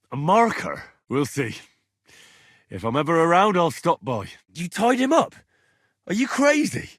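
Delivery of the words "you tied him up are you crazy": can't believe what he's hearing; high, incredulous outburst; shocked disbelief. Acted. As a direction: pitch shoots up in disbelief. Shocked at friend tied up.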